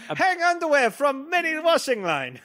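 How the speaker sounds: high put-on accent; broken-English accent. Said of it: "accent humor".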